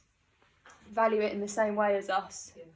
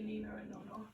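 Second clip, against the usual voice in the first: whispering; 14 dB quieter, faster than usual. Real, not acted. Tender ending of the poem.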